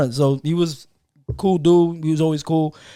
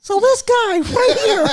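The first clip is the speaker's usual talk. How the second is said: silly high voice; slower than usual. Pretend. Jokingly switches to silly voice.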